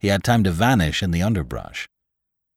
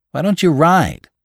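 high, louder question; pressing driver; 10 semitones higher, 6 dB louder than usual. A narrator's reading. Driver asking Lantry why he won't ride, pressing. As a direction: press him, voice pitched up high.

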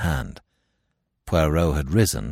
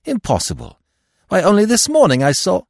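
high, loud disbelief, breaking off; shocked cry; 8 dB louder than usual. A narrator's reading. Narrator protesting that the dagger is gone, shocked cry. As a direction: protest in astonishment, pitch leaping up. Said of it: "I cried".

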